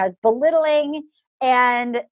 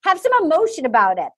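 normal talking voice

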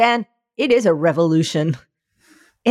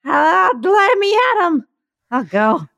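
put-on slurred, shouting drunk voice; hammed-up drunk yelling for a fight; town drunk; 5 semitones higher, slower than usual. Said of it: "fucking wasted, just ripped".

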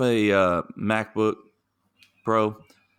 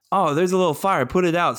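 alarmed shout; 8 semitones higher, 4 dB louder than usual. Retold or imitated. Friend's fire starts spreading.

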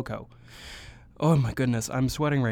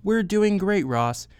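mock yell; 8 semitones higher, 5 dB louder, slower than usual. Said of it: "you immediately yelled back".

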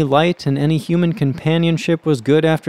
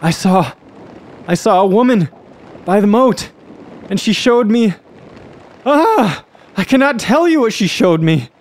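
halting, high-pitched panic; acted horror, broken into gasped phrases; terrified gasping; 8 semitones higher than usual. A narrator's reading. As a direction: falter and cry out, unable to tell it. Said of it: "gasped the terrified man".

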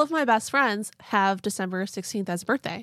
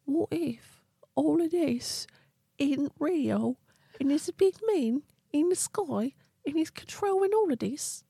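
wondering peasant voice; 8 semitones higher than usual. Retold or imitated. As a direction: muse it like a naive dreamer. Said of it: "and you're like".

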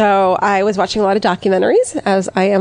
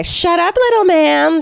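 imitating a bickering neighbor, higher; a sharp, high-pitched put-down; high mocking yell; 10 semitones higher, slower than usual.

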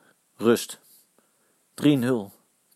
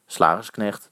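ordinary delivery

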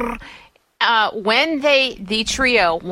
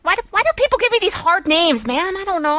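higher, quick mock exasperation; exasperated whine; 9 semitones higher than usual. Real, not acted. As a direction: complain playfully, pitch up. Complaining about hard names.

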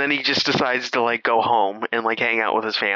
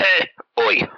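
beaver voice; 7 semitones higher than usual. Retold or imitated. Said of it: "the beaver goes".

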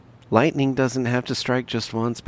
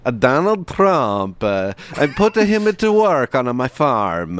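put-on Ukrainian accent; 4 semitones higher, 4 dB louder than usual. Joking Ukrainian voices.

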